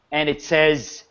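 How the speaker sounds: normal talking voice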